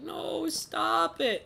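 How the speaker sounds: high, drawn-out protest; mock protest. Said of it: "It's like".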